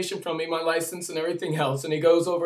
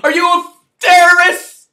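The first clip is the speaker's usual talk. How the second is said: much higher, loud screamed accusation; screaming cop; 11 semitones higher, 15 dB louder than usual. Retold or imitated. Officer screaming the accusation at the speaker. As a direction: scream the question at him. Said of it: "he starts screaming at me".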